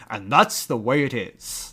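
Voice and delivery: a hint of a grave newsman signing off; Walter Cronkite. Quoting the professor's sign-off to the camera, slow mock anchorman. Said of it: "and he goes".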